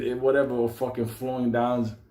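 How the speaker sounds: ordinary delivery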